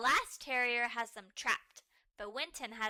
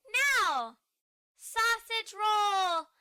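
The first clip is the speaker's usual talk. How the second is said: loud, high playful yell; slow stretched-out call of the trick; excited shout; 10 semitones higher, 10 dB louder, much slower than usual. Acted. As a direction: belt it out. Child shouting playful command.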